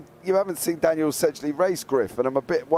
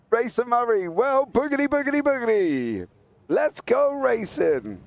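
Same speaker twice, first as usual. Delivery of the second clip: high, drawn-out hype call; catchphrase call; 11 semitones higher than usual. Pretend. Commentator hyping the race start to Murray, high hype call.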